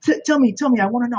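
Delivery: high, eager Italian-accented imitation; lightly put-on Italian accent, urging; Gianmarco. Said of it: "He's got this awesome Italian accent".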